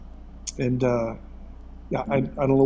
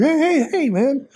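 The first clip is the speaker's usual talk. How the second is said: much higher, louder hey hey hey; excited greeting; 13 semitones higher, 4 dB louder, slower than usual. Retold or imitated. Greeting the jogger on the trail with excited high calls. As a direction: call out to him like an old buddy.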